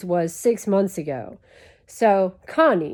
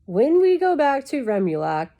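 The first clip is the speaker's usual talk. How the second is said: higher-pitched imitation of a lecturing alien dad; mock a droning Conehead father, pitched up; 6 semitones higher, 3 dB louder than usual. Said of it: "He's like".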